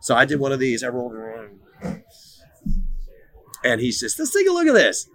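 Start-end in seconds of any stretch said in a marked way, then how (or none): 4.2-5.1 mock stern dad. Dad quoted, high.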